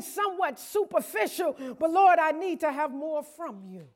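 loud, pleading prayer; fervent prayer. Acted. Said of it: "get in a place and say".

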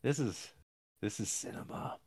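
hushed, reverent mock praise; whispered tongue-in-cheek admiration; mock awe. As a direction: breathe it like a smitten critic. Mock awed film-buff remark.

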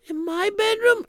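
soft, high, timid housewife voice; gentle wife voice. Acted. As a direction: ask politely in a high, hesitant voice. Wife voice surprised.

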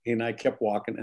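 ordinary talk, nothing marked

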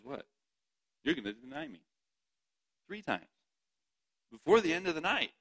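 incredulous Jesus. Acted. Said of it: "Yet Jesus says".

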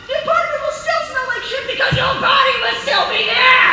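loud mock-threat yell; playful shout. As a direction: shout the punchline.

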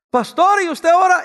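high, loud prying question to the pastor; nosy questioner. Retold or imitated. Acting out a nosy church member. (feligrés entrometido, voz aguda y fuerte)

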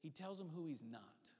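ordinary delivery

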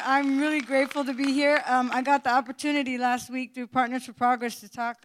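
normal talking voice

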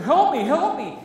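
loud, high begging cry; desperate plea. Retold or imitated. Stricken king begging God for help, loud and high. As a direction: call out for rescue, frantic. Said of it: "and he prays".

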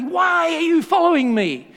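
loud, high shout across the train doors; retold yell. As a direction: yell it back as the doors close. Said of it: "he yelled back at me".

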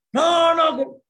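high, very loud protesting cry; panicked refusal. Acted. Man refusing to lose his wife, loud desperate protest. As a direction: shout it down, voice leaping up.

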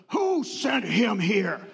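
slow, gruff demand; mock the curt doctor asking who sent him; rude doctor. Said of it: "he goes".

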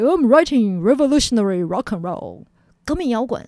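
loud, fervent quoted proclamation; fervent declaration. Retold or imitated. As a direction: proclaim it like a revolutionary slogan. Acted quote of defiant writer.

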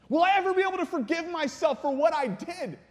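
high, loud, urgent demand; desperate plea. Acted. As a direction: burst out pleading with God. Saul pleading back to whispering God.

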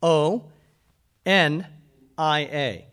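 slow letter-by-letter spelling; deliberate spelling-out. Shown as a demonstration. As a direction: spell each letter slowly. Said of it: "You want me to spell it for you?".

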